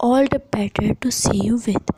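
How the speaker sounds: plain reading voice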